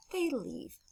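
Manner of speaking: ordinary talk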